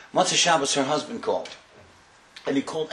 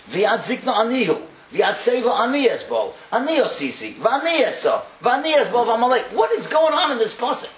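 high, loud chanted verse; fervent preaching; 10 semitones higher, 6 dB louder than usual. Acted. Rabbi reading the pasuk aloud to the women, loud and fervent. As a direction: ring out the Hebrew verse.

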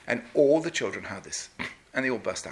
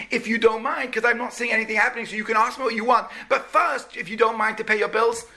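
angry shopkeeper; 8 semitones higher, 4 dB louder than usual. Acted. Shopkeeper scolds over unpaid bill.

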